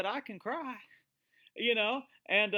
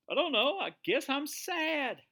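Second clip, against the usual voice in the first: plaintive answer; 6 semitones higher than usual. Retold or imitated. Preacher replaying his answer to God, plaintive. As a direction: protest helplessly, pitch lifted and last words stretched.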